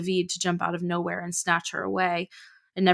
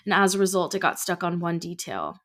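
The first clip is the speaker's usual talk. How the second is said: ordinary delivery, nothing marked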